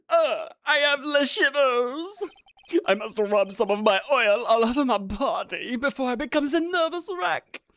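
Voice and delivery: put-on high French-accented chef voice.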